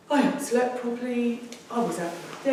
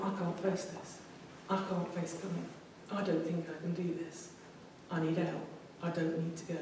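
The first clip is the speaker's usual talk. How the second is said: anxious inner voice; 8 dB quieter than usual. Retold or imitated. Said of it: "I thought".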